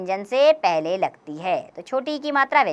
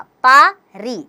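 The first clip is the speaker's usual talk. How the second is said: पा री said slowly, apart and a little louder; syllable by syllable; 2 semitones higher, 5 dB louder, much slower than usual. Shown as a demonstration. Teacher building a word for pupils, slow separate syllables. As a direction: sound out पा and री separately. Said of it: "स में छोटे की मात्रा सु".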